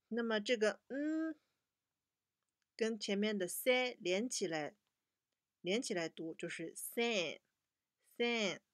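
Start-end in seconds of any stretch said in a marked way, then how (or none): none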